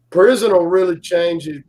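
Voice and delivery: usual speaking voice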